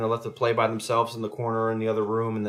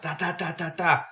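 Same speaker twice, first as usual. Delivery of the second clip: high staccato gunfire imitation; imitated gunshots; 6 semitones higher than usual. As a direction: voice a burst of gunfire in quick syllables. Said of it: "he hears gunshots".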